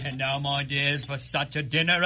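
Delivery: usual speaking voice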